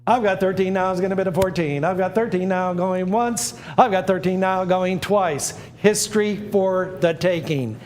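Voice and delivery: auctioneer calling the bids. Acted. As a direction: cry out going once, going twice. S0 runs the auction calls.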